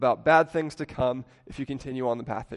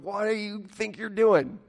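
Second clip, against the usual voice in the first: higher, a touch of caring alarm; lightly voiced friend scolding someone he cares about; aggressively caring; 6 semitones higher than usual. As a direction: scold out of love. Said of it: "aggressively caring for someone going".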